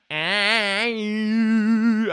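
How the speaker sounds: high, loud head-voice sing. Shown as a demonstration. Singer showing his old head voice, high and loud. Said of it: "I was always using my head and voice".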